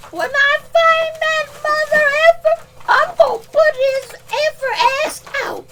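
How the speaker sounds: high put-on threat voice; high mock threat; high angry voice. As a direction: threaten in a squeaky furious voice.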